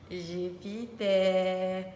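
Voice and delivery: JUPITER stretched out very slowly, syllable by syllable; drawn-out word. Pretend. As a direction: draw out every syllable of Jupiter playfully. Second take, stretched out for fun. (Jupiter étiré, syllabe par syllabe)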